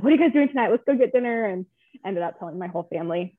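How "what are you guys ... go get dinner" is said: eager, higher and louder call; bursting to share good news; excited invite. Retold or imitated. Mother calling her family after the scan, eagerly excited. Couldn't wait to tell family.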